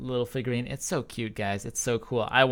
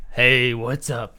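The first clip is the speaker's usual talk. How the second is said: slow, louder casual greeting; normal non-casting voice; 6 dB louder, much slower than usual. Shown as a demonstration. Caster shows his everyday voice off-mic, slow casual greeting. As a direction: drop the caster voice and just say hi. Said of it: "that was just me doing my, uh, that's my normal voice".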